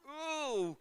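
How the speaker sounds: high, sharp ooh of fright; startled ooh. Retold or imitated. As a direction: gasp it out, caught off guard. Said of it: "I'm like".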